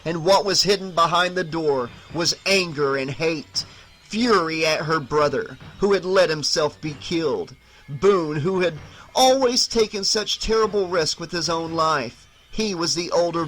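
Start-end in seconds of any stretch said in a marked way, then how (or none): none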